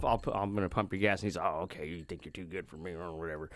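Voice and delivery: creepy low man. Retold or imitated. Creepy attendant sulking at the woman, low soft grumble.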